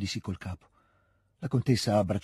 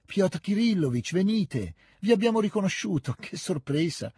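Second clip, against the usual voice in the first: high, excited call of greeting; 8 semitones higher than usual. A narrator's reading. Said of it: "gridò stendendogli la mano". (richiamo acuto e festoso)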